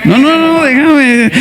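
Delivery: put-on high, drunken no no no; mock drunk protest. Pastor as drunk refusing to go home, high mock whine. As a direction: brush him off like a tipsy man. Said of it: "Es así".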